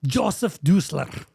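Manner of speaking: slow, deliberate German-accented name; measured German pronunciation. Shown as a demonstration. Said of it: "Yes, like that".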